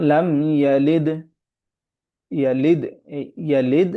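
ইয়ালিদ ending in a bounced, pushed দ; qalqala on dal. Shown as a demonstration. Teacher showing qalqala on the final dal. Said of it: "কলকলা করে পড়ব".

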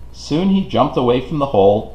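plain reading voice

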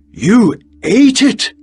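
a shocked, drawn-out cry of disbelief; startled guest crying out; excited disbelief. Acted. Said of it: "he stood up excitedly".

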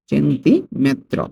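"সেন্টিমেত্র" dictated slowly in two pieces; broken into parts. Shown as a demonstration. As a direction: lift and stretch "centi", then place "metro". Teacher repeats word by parts.

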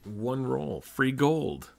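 loud, eager burst; excited. Real, not acted. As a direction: cheer it out happily. Excited at getting free gold.

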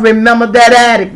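normal talking voice

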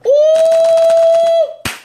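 a sudden high squealing ooh; high shriek. Real, not acted. Vlogger shrieking at someone's outfit, high squealing ooh.

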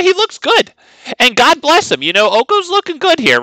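high camp cowboy voice. Acted. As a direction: sing out high like flamboyant Oko. Oko voice again.

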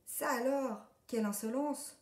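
put-on outraged old pony; indignant exclamation. A narrator's reading. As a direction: sputter at the rude duckling. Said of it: "s'écrie Papou".

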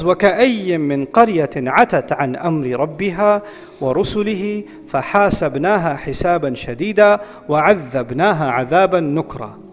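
fast, low chanted Arabic verse; rapid Quranic recitation. Acted. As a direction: recite the ayah in a quick flowing chant.

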